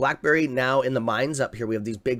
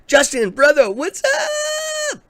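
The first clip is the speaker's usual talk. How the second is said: excited greeting; 7 dB louder, much slower than usual. Real, not acted. Greeting a viewer by name.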